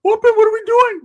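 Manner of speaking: very high, loud cartoonish yelp; hammed-up overexcited cousin voice; Ricky. Cousin just dropped off for the weekend, squealing excitement.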